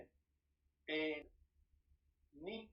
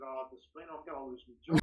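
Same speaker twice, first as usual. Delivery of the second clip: mock pleading; 8 dB louder than usual. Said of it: "I'm trying to con Despina".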